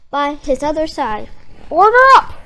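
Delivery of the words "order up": higher, louder triumphant call; triumphant cry. Acted. SpongeBob announcing the fixed patty, triumphant cry. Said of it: "cried SpongeBob".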